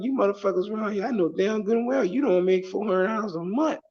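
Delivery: high, quick, worked-up rant; indignant argument. Retold or imitated. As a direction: argue the price down in exasperation. Acts his haggling argument back.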